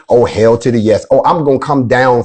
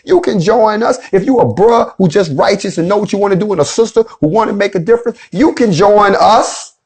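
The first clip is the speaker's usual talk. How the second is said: higher, ringing rally-preacher cadence; repeating the invitation up high, stirring the crowd; rousing preacher call; 7 semitones higher than usual. Acted. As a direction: call them in like a preacher at the pulpit.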